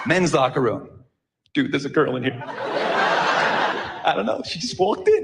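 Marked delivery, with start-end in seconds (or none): 1.5-5.2 lower, slow bro voice, half-laughing; laid-back dude. Retold or imitated. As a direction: drawl it out like a pleased guy in the locker room. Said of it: "Same words, different intonation".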